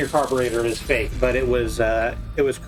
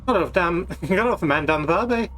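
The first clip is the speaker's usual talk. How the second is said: put-on higher, laughing stage-Irish accent; bad Irish accent; 6 semitones higher than usual. Streamer riffs on bootleg carburetor in bad Irish accent. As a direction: ham up a pub-talk Irish accent, breaking into a laugh. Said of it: "it's a bad Irish accent".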